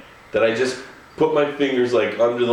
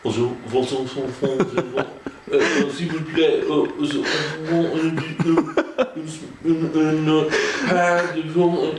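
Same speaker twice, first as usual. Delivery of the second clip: mock bad French; 3 semitones higher than usual. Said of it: "speaking French to the people behind the counter".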